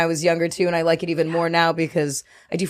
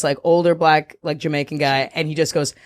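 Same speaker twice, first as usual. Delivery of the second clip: usual speaking voice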